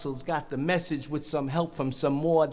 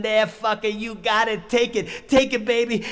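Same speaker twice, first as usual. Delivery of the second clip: raised, forceful shout; acted yelling; 3 semitones higher, 5 dB louder than usual. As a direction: drive each phrase out hard and loud.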